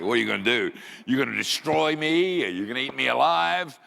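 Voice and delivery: loud, high mock alarm at a tiny threat; mocking taunt. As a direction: scoff loudly with the pitch pushed up.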